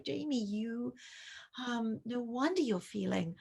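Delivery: validating and tender. Shown as a demonstration. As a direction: comfort her by sharing your own story. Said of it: "validate what she's feeling".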